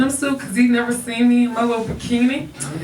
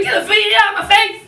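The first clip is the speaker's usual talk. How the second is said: fast, high, louder snap; exasperated refusal; 9 semitones higher, 4 dB louder, faster than usual. Acted. Refusing a fajita offered on Cinco de Mayo. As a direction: brush the fajita away.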